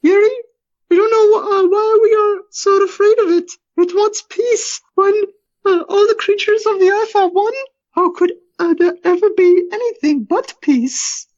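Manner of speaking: very high, tearful falsetto; longing high wife's voice; creature speaking as wife. Acted. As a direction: plead to your husband in a high, crying voice. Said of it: "The Alina thing speaks".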